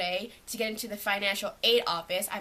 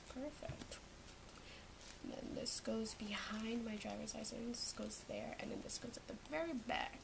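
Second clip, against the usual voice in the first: whispering to herself; 19 dB quieter than usual. Real, not acted. Whispering to herself while sorting.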